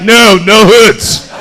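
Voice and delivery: loud, drawn-out mimicked answer.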